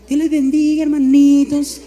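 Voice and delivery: lightly voiced gentle wife; a hint of a sweet church lady; sweet little voice. Preacher imitating his wife's sweet little greeting, lightly voiced. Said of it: "con esa vocecita".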